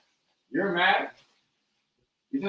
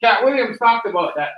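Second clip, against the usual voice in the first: calling out; 4 semitones higher, 7 dB louder than usual. Real, not acted. Calling out over others.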